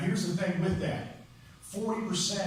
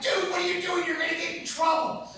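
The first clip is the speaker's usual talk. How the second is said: much higher, freaked-out partner voice; panicked partner; 12 semitones higher, 3 dB louder than usual. Retold or imitated. Partner freaking out at the paramedic, high and panicky. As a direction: squeak it out in alarm.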